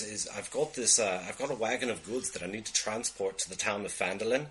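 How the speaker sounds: Scottish dwarf cousin offering a job; Scottish accent. Acted. Cousin asks Corgan to escort a wagon, Scottish accent. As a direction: play a hesitant Scottish employer.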